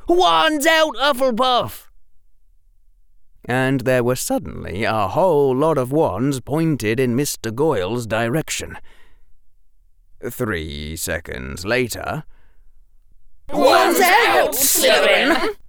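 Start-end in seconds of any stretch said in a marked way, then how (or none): 0.1-1.9 high, rapid rallying shout; rally the house at a yell; high-pitched boyish battle cry. A narrator's reading. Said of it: "shouted Ernie". 13.5-15.6 loud, high-pitched house war cry; high rallying yell; group rallying cry. A narrator's reading. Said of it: "said around five different Slytherins".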